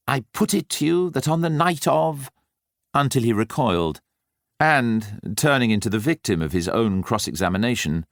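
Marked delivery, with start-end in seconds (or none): 0.1-2.3 mock barrister's sneer, pitched higher; hammed-up courtroom scorn, rising; mounting contempt. A narrator's reading. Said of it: "saying with mounting contempt".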